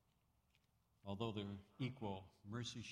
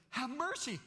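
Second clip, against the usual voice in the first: much higher and louder plea; 14 semitones higher, 8 dB louder than usual. Acted. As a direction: cry out the plea. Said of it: "No, he says".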